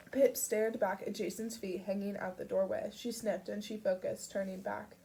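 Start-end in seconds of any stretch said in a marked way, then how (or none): none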